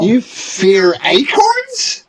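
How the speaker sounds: high, slow scornful gnome; a gnome sneering at cowards; mocking gnome. Gnome mocks.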